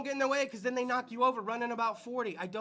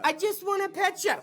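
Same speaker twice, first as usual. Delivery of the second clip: put-on higher, sweet little kid voice; little kid asking to pet a goat, high and sweet; child voice; 8 semitones higher than usual. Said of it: "kid's line".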